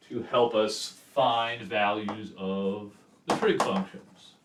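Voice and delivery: ordinary talk, nothing marked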